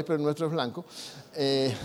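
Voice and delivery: ordinary talk, nothing marked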